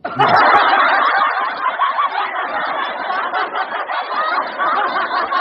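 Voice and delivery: high, breathless silent wheeze laugh; wheezing laugh. Real, not acted. Breaks into laughter.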